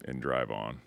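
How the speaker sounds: soft, low, reassuring; calming. Retold or imitated. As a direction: talk a worried worker down gently. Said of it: "Calm the Fuck Down".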